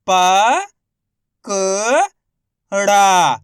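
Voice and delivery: प, क, then a loud long ड़ा; isolated letters, ड़ा stretched. Shown as a demonstration. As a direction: hold each sound apart with pauses. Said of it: "एक अक्षर का उच्चारण करके".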